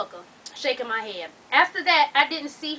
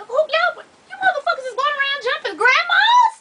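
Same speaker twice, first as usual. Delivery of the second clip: high-pitched, loud incredulous outburst; outraged disbelief; 14 semitones higher, 6 dB louder than usual. Real, not acted. Reader scolding people who jump grandmas, outraged. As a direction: scold them in squealing disbelief.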